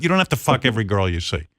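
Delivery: normal talking voice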